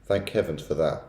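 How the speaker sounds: ordinary delivery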